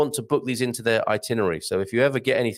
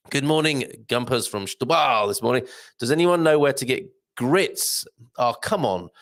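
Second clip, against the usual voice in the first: ordinary talk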